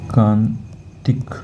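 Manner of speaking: एकांतिक drawn out slowly, syllable by syllable; slow clear pronunciation. Shown as a demonstration. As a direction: spell out एकांतिक slowly for the class. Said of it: "एकांतिक होता है".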